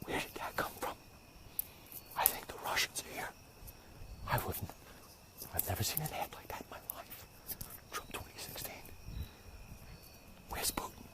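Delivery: whispered in disbelief; mock whisper. Viewer seeing the ad, spooked whisper. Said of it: "and you're like".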